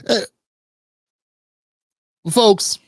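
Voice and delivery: ordinary talk, nothing marked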